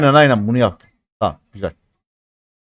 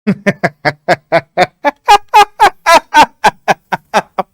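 burst of laughter; 7 semitones higher, 5 dB louder than usual. Real, not acted. Bad drop result.

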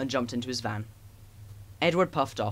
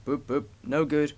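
grumbling Gordon. A narrator's reading. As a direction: grumble it like a sulky big engine. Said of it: "grumbled Gordon".

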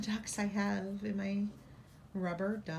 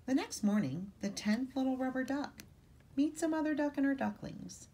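put-on reading to children; 2 semitones lower than usual. A narrator's reading. Reading picture book aloud.

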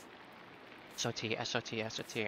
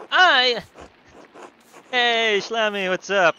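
loud, higher cartoonish greeting; Vinny Mario voice; 6 semitones higher, 7 dB louder, slower than usual. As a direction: call out a hammed-up hello. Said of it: "like, the Vinny Mario voice".

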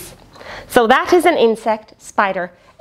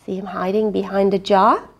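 usual speaking voice

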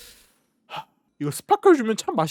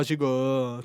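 normal talking voice

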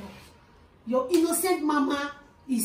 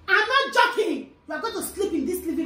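stern warning; 5 semitones higher, 5 dB louder than usual. Acted. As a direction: draw it out loudly as a threat. Mother threatens punishment.

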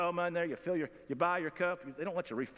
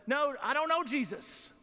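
heated denial; 9 semitones higher, 3 dB louder than usual. Retold or imitated. Peter denies knowing Jesus.

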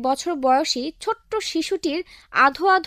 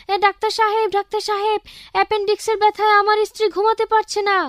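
panicked caller; 7 semitones higher than usual. Acted. Uncle calls doctor again.

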